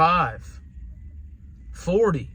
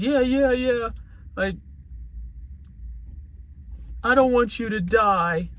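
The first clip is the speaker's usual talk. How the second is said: high, pleading child's cry, acted; imitated crying daughter; 8 semitones higher than usual. Daughter pleading with her smoking father, imitated hysterical crying. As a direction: retell a hysterical little girl, pitched way up.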